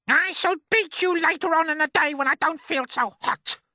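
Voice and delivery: high, loud, menacing aunt; threatening aunt. Acted. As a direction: threaten the boy coldly. Aunt Spiker threatens James.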